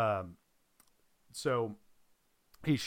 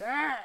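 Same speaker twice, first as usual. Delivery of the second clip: high, shrill dinosaur screech; pterodactyl screech; 4 dB louder than usual. Retold or imitated. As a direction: squawk like a pterodactyl. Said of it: "do an impression of a pterodactyl".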